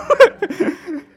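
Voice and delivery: loud high burst of laughter; big laugh. Real, not acted. Preacher laughing at his own story, a loud high laugh.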